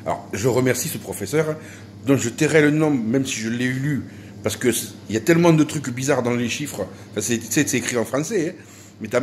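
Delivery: ordinary talk, nothing marked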